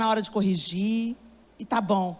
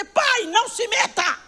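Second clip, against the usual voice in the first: angry shout; 9 semitones higher, slower than usual. Retold or imitated. Daughter shouting at her father to stay out. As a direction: snap at your father to stay out.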